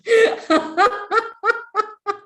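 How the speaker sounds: high, long rolling laugh; high staccato laughter; exercise laugh. Shown as a demonstration. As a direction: burst out, then let it tail away. Teacher laughing between instructions.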